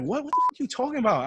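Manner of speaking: high, loud, fast mock yell; yelling officer. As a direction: fire it back like an irate cop. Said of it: "yelling at me".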